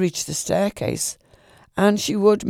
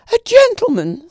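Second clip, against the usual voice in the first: much higher, louder frightened shriek; terrified scream; 9 dB louder than usual. A narrator's reading. Lady discovers a man in her room, terrified scream. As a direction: scream it in sheer terror. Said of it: "with a terrified scream".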